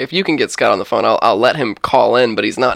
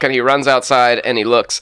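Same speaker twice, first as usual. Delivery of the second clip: normal talking voice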